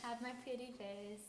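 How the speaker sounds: soft playful murmur. Real, not acted. Posing for makeup on camera.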